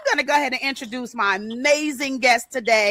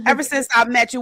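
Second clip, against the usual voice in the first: usual speaking voice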